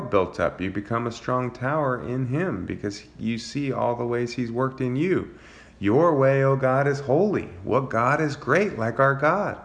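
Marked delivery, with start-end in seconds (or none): 5.8-9.6 higher, louder proclaiming read; scripture reading. Acted. Pastor reading psalm praise aloud, higher and louder.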